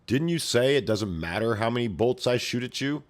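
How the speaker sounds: usual speaking voice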